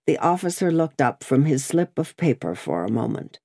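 plain reading voice

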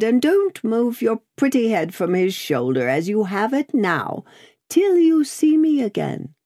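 high character voice, fussing affectionately; Miss Pross, fondly bossy. A narrator's reading. Miss Pross instructs Lucy before leaving.

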